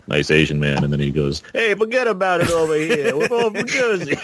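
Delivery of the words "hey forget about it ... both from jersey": higher, hammed-up New Jersey wiseguy accent; mock Jersey mobster. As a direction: play a Jersey goombah, breaking into a laugh. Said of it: "He turns into Tony Soprano".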